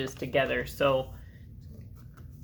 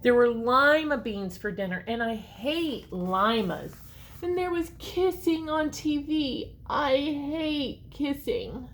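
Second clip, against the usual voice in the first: put-on lively read-aloud for children; story narration; 5 semitones higher, slower than usual. A narrator's reading. Reading a story to children, lively and expressive. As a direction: read it like a picture book to kids. Reads picture book aloud.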